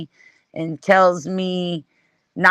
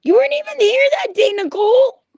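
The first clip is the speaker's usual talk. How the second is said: much higher, fast, calling out to Nicole; high excited call; faster than usual. Real, not acted. Streamer calls out to Nicole in a high, fast excited voice.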